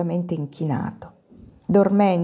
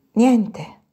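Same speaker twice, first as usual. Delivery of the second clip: melancholy; 3 semitones higher than usual. A narrator's reading. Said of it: "con la malinconia di una voce giovanile che i singhiozzi hanno velato".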